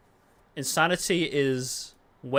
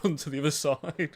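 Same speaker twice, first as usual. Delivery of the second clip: laughing; 2 semitones higher than usual. Real, not acted. Player joking about his own fitness, laughing. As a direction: talk through a laugh.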